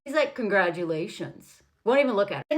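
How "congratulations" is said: low, slow, unimpressed congratulations; passive-aggressive. Retold or imitated. Boyfriend answering her book deal news, passive-aggressive. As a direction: drag it out low, with no joy. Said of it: "The passive aggressive".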